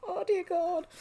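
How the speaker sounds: high sigh after laughing; real amused groan of disbelief; laughing exasperation. Real, not acted. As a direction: recover from a fit of laughter. Recovering from a laughing fit.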